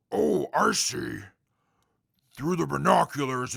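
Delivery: usual speaking voice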